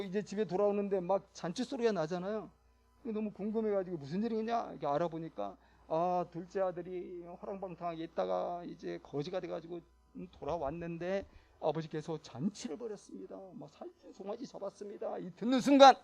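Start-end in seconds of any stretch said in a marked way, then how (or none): none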